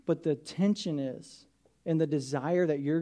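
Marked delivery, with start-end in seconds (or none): none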